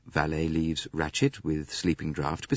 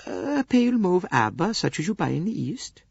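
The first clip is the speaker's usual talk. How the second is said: much higher, gentle Swedish lady; Greta Olson voice; 12 semitones higher than usual. Acted. Swedish lady answering Poirot's questions, high gentle voice. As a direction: lift into a mild, earnest Swedish companion.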